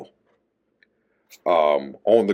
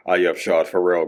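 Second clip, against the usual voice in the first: normal talking voice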